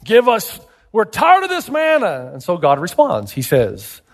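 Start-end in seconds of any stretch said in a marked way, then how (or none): 0.0-2.3 high-pitched, protesting demand; rioting crowd. Acted. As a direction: chant like an angry mob demanding food. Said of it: "People are rioting".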